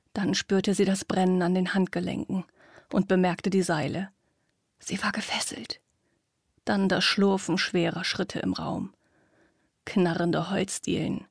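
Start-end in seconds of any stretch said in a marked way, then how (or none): 4.8-5.8 soft, hushed dread; whispered narration. A narrator's reading. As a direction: whisper the shock quietly.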